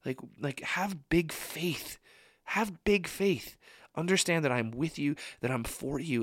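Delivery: soft, earnest reassurance; kind, pleading encouragement; gentle, not angry. Acted. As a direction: encourage tenderly, drawing out key words. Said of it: "isn't angry with us, but he's just saying".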